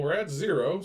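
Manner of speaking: ordinary talk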